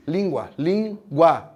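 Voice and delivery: "língua" whole, then slowly split LÍN-GUA; separado em sílabas. Shown as a demonstration. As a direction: say "língua", then its two syllables apart. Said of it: "como é que se separa em sílabas?". ("língua" inteira e depois separada, LÍN – GUA, devagar)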